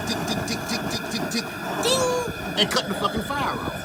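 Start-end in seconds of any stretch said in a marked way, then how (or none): 0.1-2.6 timer ticking sounds. Retold or imitated. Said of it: "so it don't turn and tick".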